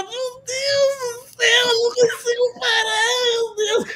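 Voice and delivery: very high, squealing mock helplessness; esposa em crise de riso. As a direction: imitate a wife helplessly overcome, in a high wail. Wife stoned, cannot stop laughing. (imitação aguda de esposa descontrolada)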